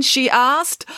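ordinary delivery

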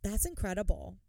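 deadpan fake awe; sarcastic wow. Real, not acted. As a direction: pretend to be impressed. Sarcastic reaction to acts of service.

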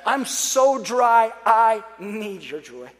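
slow, drawn-out, emphatic plea; pleading prayer. Acted. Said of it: "I just begin to pray".

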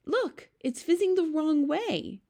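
put-on very high-pitched girl's exclamation; surprised cry. A narrator's reading. Sophie sees bubbles sinking, crying out. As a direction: exclaim high, stretching the last words. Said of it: "Sophie cried".

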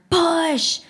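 a loud, high single-word shout; shouted push. A narrator's reading. Narrator voicing the crowd pushing the car, loud shout. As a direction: bark it to the crowd.

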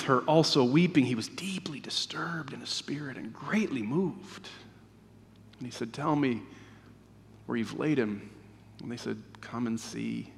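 low, hushed, sorrowful narration; solemn scripture reading. A narrator's reading. Reading of Jesus weeping to congregation, hushed and solemn. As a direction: read softly, letting the grief sit in pauses.

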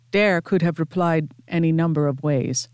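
ordinary delivery, nothing marked

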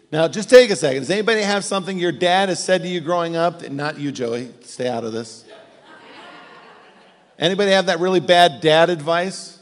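ordinary delivery

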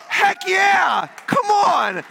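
high, fired-up shout; rousing cheer. Real, not acted. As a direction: whoop it to rally the crowd. Rousing congregation to cheer like fans.

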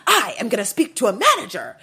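exaggerated imitation of an irate complainer; mocking yell. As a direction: mimic his indignant yelling. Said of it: "he's literally yelling at this woman".

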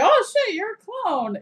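sudden jump up for the first words; startled at spotting a clone; mock surprise. Driver realizing his passenger is a clone, mock surprised. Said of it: "He's like".

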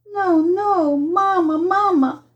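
put-on high, wailing child's cry; distressed pleading. A narrator's reading. Boy begs mamma not to let him go, distressed pleading. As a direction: wail for mamma in rising desperation.

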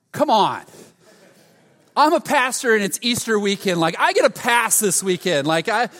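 loud, high complaining rant; hammed-up self-pity, pleading with the world; whining. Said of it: "whining and feeling bad for myself".

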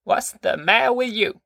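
high-pitched granny demanding an answer; demanding grandma. A narrator's reading. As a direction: demand it in a high old-woman voice. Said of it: "she demanded of Grandpa".

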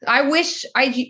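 ordinary talk, nothing marked

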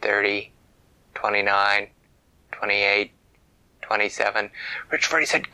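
ordinary delivery

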